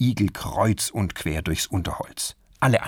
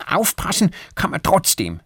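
high squirrel voice, mildly cross; a touch of childish anger; angry; 8 semitones higher, 3 dB louder than usual. Acted. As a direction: snap back like a cross little squirrel. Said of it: "sagt Erik wütend".

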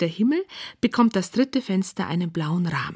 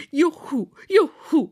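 high-pitched gleeful whoop; jubilant cheer; 7 semitones higher, 3 dB louder, slower than usual. A narrator's reading. Knight hopping in his new blue sock, jubilant.

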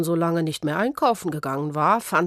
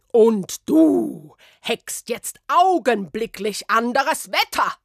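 shrill, much higher and louder; outraged official; 9 semitones higher, 5 dB louder than usual. Acted. Ministry official catching the witch reading, shrill outrage. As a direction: screech the outrage at her.

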